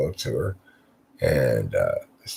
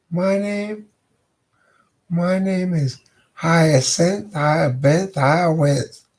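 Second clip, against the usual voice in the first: much higher teasing woman's voice; 10 semitones higher, slower than usual. Acted. The lady at the door teases Fabian in a high voice. Lady says her name.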